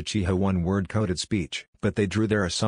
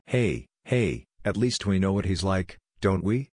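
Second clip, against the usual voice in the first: plain reading voice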